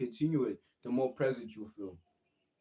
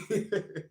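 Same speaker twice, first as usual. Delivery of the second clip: giggle; 9 semitones higher than usual. Real, not acted. Laughing through apology.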